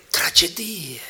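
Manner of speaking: a hushed stage whisper. Acted. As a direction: breathe the word out.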